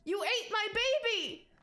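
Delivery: high, tearful accusing voice; a sobbing mother refusing to back down; shrill grief-stricken accusation; hysterical rabbit woman. Acted. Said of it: "She's, like, hysterical".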